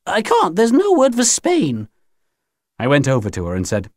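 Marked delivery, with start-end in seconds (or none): 0.1-1.9 high, drawn-out protest; slow high-pitched refusal; protesting alien aunt. Acted. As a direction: insist it cannot be done, slowly. Alien aunt protests she cannot.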